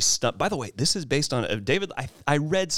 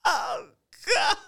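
high shocked gasp. Retold or imitated. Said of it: "she's just going".

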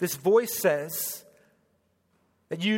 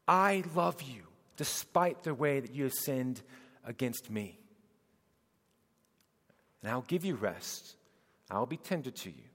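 soft, low and hushed; tender; 6 semitones lower, 7 dB quieter than usual. Acted. Jesus tenderly inviting weary sinners to rest, soft and low. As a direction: speak it as a gentle promise.

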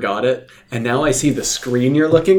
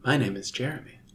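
lightly put-on, very soft voice; a hint of an affected quiet voice; put-on intro voice; 13 dB quieter than usual. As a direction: introduce yourself in a hushed mock-announcer voice. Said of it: "say it in your normal voice".

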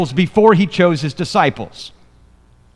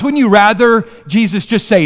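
ordinary delivery

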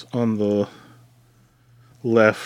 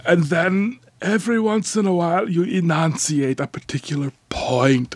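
put-on a gentle Austrian accent, higher and hushed; Werner Herzog impression; 6 semitones higher than usual. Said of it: "Arnold Schwarzenegger with, like, a sore throat".

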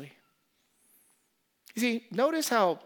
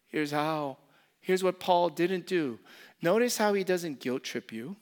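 ordinary talk, nothing marked

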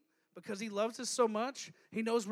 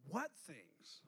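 soft, lower, feigned innocence; playing dumb; 6 semitones lower, 8 dB quieter than usual. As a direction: ask it like you know nothing, teasingly. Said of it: "Jesus has a sense of humor".

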